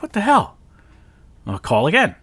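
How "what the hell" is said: shocked, pitched way up; baffled what the hell. Retold or imitated. Caller baffled as the company hangs up, exclaiming in disbelief. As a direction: exclaim in disbelief at the dead line. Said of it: "I'm like".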